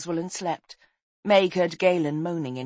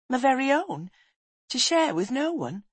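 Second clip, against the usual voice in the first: put-on high, wondering boy's question; delighted boy; 8 semitones higher than usual. Boy shown his own room, delighted. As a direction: ask in delighted disbelief, pitched up.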